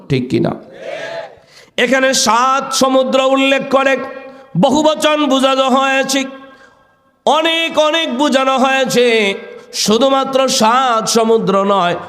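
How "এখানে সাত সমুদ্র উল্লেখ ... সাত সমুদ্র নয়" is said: high sermon chant on a held note; preacher's intoned high call; impassioned preaching. Acted. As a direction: proclaim the lesson high and ringing.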